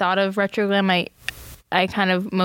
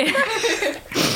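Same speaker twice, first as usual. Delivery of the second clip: high-pitched laugh; 14 semitones higher than usual. Real, not acted. Laughs at the request.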